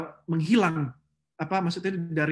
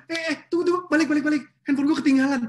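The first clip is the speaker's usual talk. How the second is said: higher, louder frantic calling; panicked forgetting phone; 8 semitones higher, 5 dB louder, much faster than usual. Retold or imitated. Someone panics to the driver after forgetting their phone.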